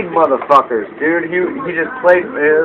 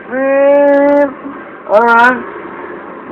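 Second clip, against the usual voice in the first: much higher, gloating put-on voice; mock casino boss; 10 semitones higher, much slower than usual. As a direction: play the casino baiting its players. Casino fishing for players.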